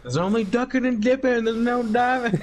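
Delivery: high, strained mock whine; mock squeaky voice. Friend mimics the game's complaint in a squeaky voice. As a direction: squeak it out like a clueless player.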